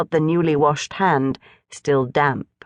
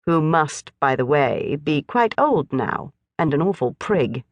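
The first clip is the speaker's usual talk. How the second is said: ordinary delivery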